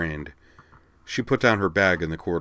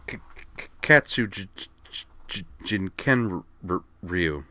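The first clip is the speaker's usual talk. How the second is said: heavy stutter on each name; stammering. A narrator's reading. Kate answering Miki with a single term, stammering. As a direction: stick repeatedly on the opening consonants.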